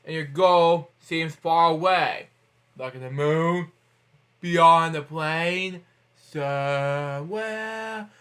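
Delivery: very slow, drawn-out and grandiose; mock dramatic reading. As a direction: stretch each word like an epic finale. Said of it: "Okay, joking, joking".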